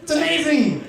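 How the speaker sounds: giddy whoop then breathless awe; overjoyed. Pretend. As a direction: cheer the train like a miracle. Overjoyed train arrival.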